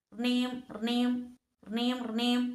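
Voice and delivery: imitated trilling phone ring; phone ringing noise. A narrator's reading. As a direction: roll out a drawn-out ring-ring. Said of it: "നൂൽ ഫോണടിച്ചു".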